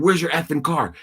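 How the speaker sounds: father imagining yelling at his daughter, mock angry. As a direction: snap the question like an enraged dad. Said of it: "I would have called her in ego, in fear".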